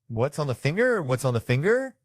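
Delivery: high, rising dog questions; high-pitched talking dog; eager dog voice. Retold or imitated. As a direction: voice a curious dog, each question lifting at the end. Voices the dog coming back.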